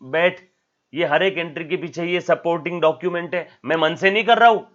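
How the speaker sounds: sit them down and insist loudly; firm accountant reply. Retold or imitated. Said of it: "तो बोलेगा".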